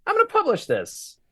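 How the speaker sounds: high-pitched mocking of a smug guy; mock Scott Adams. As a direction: toss it off like it's no big deal.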